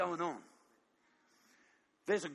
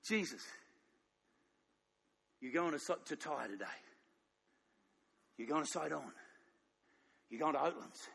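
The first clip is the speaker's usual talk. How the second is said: Father's soft voice; 4 semitones lower, 5 dB quieter than usual. Acted. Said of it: "and the Father goes".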